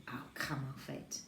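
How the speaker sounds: low, slow, tired grumble; bad-tempered. A narrator's reading. Tired boy dismisses his sister's kind remark, bad-tempered. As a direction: brush it off grumpily, voice dropped and dragging. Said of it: "always made him bad-tempered".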